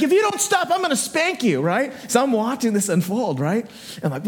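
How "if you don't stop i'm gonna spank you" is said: high-pitched mock maternal threat; mock-mad mom. Mom threatening to spank her son, mock mad. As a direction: warn like a cross mom, pitched way up. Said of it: "so she's like".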